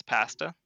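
pasta with the A of cat, corrective; Canadian hard A. Shown as a demonstration. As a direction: say pasta as PASS-ta, insisting. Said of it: "our A's are typically hard".

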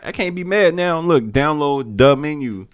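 high, slow, fake-annoyed insisting; mock exasperation. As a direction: pretend to lose patience. Mock-annoyed demand for menu.

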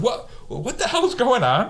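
high-pitched mock disbelief; imitated bewilderment. Baffled team after he casually climbs out, high imitated bewilderment. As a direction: voice shoots up as a baffled onlooker.

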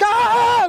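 loud, high scream of joy; excited scream. Retold or imitated. [name] hearing his producer on the phone, a loud, high scream of joy. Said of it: "They screaming and laughing".

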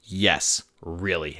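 understated, settled reply; matter-of-fact confirmation. Acted. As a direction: confirm it, dropping down low and soft. Answers the imagined doubting question.

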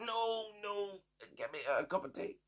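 soft, feeble granny impression, starting high; Granny Margaret. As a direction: wail a refusal like a choking old woman. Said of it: "It's a very spot-on impression".

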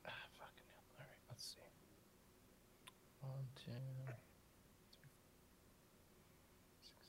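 whispered to self; whispering. Real, not acted. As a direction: whisper while counting dice. Dice frustration under breath.

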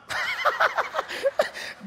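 a high, bubbling laugh; high laughter. Real, not acted. Laughs after mangmang joke. (mataas na tawa)

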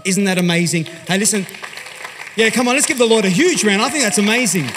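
loud, high and rousing; excited call to applause. Real, not acted. Preacher urging the crowd to clap for the Lord, loud and rousing. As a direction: whip the crowd into cheering.

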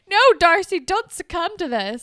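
loud, high mock cry of protest; dramatic plea. Viewer begging Darcy not to succumb, dramatic plea. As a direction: burst out hard on the first word, pleading. Retells urging character not to give in.

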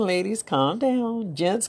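ordinary delivery

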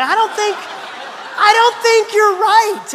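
squeaky giggling disbelief, slow at the end; laughing. Real, not acted. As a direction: laugh through the disagreement. Laughing at gruff friend.

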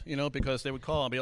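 ordinary delivery

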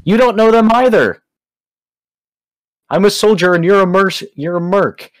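high, loud indignant protest. Acted. Arguing with the guards.

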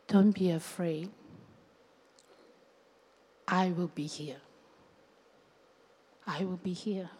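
low, soft, unhurried comfort; reassuring. Acted. Jesus reassuring someone who is sinking, soft comfort. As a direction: soothe softly, with long pauses. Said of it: "to reassure you and say".

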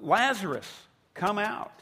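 single him out with a loud summons; loud call. Acted. Jesus singles out Lazarus to come out, loud call. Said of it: "he calls out in a loud voice".

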